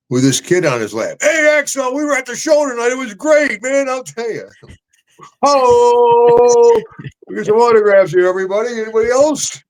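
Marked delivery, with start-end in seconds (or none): 1.2-4.5 high, gushing starstruck voice; excited fan sheriff. Retold or imitated. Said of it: "There's a sheriff". 5.4-9.6 high, loud eager call; rally everyone for autographs; eager autograph request. Retold or imitated. Sheriff asking for autographs.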